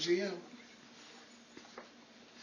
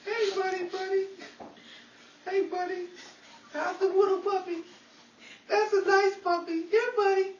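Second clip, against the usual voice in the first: sweet-talking a puppy, a bit louder; talking to a puppy in a doting voice; dog talk; 5 semitones higher, 4 dB louder than usual. Acted. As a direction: coo at the little dog like it's a baby. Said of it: "Dog talk".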